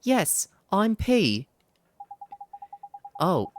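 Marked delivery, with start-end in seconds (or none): 0.0-1.5 high, slow little fairy. Acted. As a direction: stretch it out sweetly like a tiny fairy. Fairy P answers.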